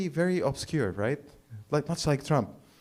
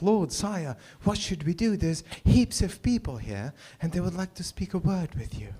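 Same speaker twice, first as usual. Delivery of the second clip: higher, mock British disciple voice; polite British petitioner, pitched up; 7 semitones higher than usual. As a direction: put on a movie-Bible British accent. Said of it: "I don't know why they're British".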